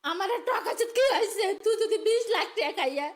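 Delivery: usual speaking voice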